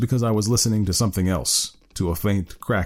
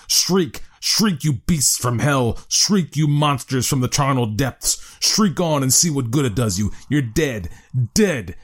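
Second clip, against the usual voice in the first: frenzied raving; 4 semitones higher, slower than usual. Acted. Friend raving at dying monsters.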